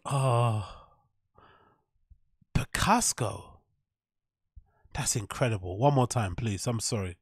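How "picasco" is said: Picasso savored slowly as "pi-KAHS-ko"; slow imitation of Picasso with an extra k; mispronounced Picasso. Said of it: "how you pronounce Picasso".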